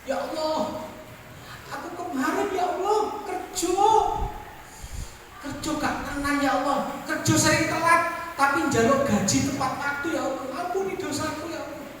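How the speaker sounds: high, pleading mock prayer to God; mock whiny prayer. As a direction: pray like a lazy worker begging forgiveness. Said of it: "vulgar-vulgarannya kalau Gusti Allah". (doa memelas yang dibuat-buat, nada tinggi)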